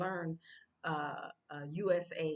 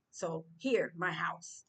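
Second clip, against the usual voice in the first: usual speaking voice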